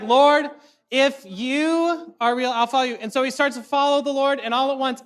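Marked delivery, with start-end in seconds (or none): 0.0-2.9 louder, very slow mock pleading; hammed-up cry to heaven. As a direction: proclaim it like the movie's big moment. Parodying movie hero's prayer.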